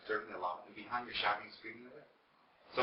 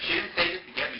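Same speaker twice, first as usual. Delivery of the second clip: normal talking voice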